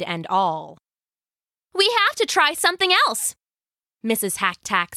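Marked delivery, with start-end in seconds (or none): 1.7-3.3 high, quick, excited voice; keen, high-pitched character voice; eager wife. Acted. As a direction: rush it out with eagerness. Wife eager to test pot.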